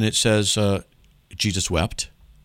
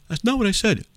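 high-pitched indignant objection, acted; mock protest; 12 semitones higher than usual. Host plays the doubter objecting to being misquoted, mock protest.

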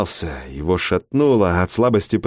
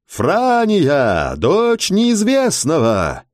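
booming, slow herald's call, much higher; town crier; 7 semitones higher, much slower than usual. A narrator's reading. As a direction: call it across the square like a herald. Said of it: "зычный голос городского глашатая".

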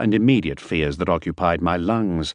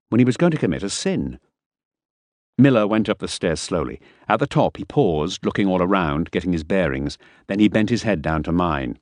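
usual speaking voice

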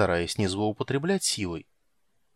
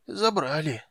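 high nervous clerk; 6 semitones higher, slower than usual. A narrator's reading. Clerk in pain.

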